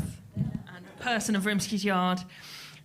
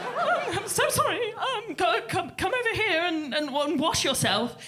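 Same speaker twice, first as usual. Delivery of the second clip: her own flustered apology, imitated much higher; gushing, panicky sorry in a raised voice; 8 semitones higher than usual. Imitating herself apologising to the angry man, much higher and flustered. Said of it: "I was like".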